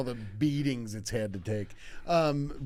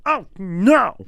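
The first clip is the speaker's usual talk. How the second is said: loud, higher comic cry of alarm; mock dismay; 7 semitones higher, 12 dB louder than usual. Teacher cuts his finger, mock alarmed cry. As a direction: imitate a teacher's sudden dismay. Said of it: "we hear him go".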